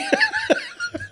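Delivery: very high-pitched laugh. Real, not acted. Whiskey taster after admitting he likes it, high laughing. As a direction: break into a high squeal of laughter.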